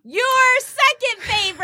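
high, stretched-out mock holler; hyped cry. Co-host hailing the letter of the day, hyped cry. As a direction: belt it out high, dragging the last word.

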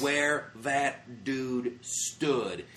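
every word of "where that dude stood" stretched out; awed emphasis. Real, not acted. Awed climax of the story.

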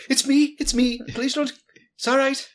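high-pitched, urgent reassurance in short bursts; urgent plea. Acted. Character at the window to an armed woman, urgent plea. Pleading at Moira's window to be spared.

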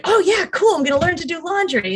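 put-on child cheerfully agreeing to learn laundry; excited child. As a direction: play the kid thrilled to learn something new. Voicing her child learning laundry.

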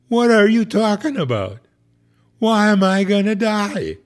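high, loud, indignant disbelief; shocked outrage. Retold or imitated. Hernia patient protesting to the priest, loud and indignant.

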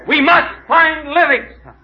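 slow, loud and high, full hammed-up heroics; grand vow. Explorer to the kid after another failure, big hammed-up vow. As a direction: drag out a big heroic proclamation.

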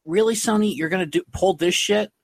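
high, incredulous fan outrage; disbelieving fans calling out Sony; outraged. Retold or imitated.

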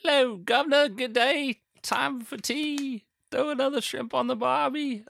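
high, mock-posh British put-on; a jokey hammed-up British accent; half-hearted British accent. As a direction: toss off a lazy English accent. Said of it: "Half-hearted fucking impression".

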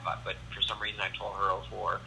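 usual speaking voice

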